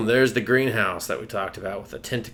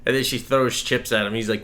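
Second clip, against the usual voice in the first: normal talking voice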